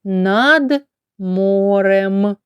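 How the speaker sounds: Над морем said very slowly, word by word. Shown as a demonstration. Teacher modelling the phrase slowly. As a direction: draw out Над морем for the class. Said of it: "Ми можемо скласти таке повідомлення".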